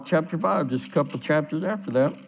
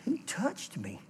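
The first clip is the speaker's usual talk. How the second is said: softer, searching "Who touched me?"; Jesus quietly looks round the crowd; hushed; 4 semitones higher, 13 dB quieter than usual. Acted. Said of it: "He's going".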